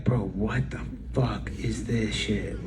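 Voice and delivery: low, slow whisper of a scoffing fan; imagined fan reaction. Retold or imitated. Artist imagines fans mocking his new song, whispered. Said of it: "be like".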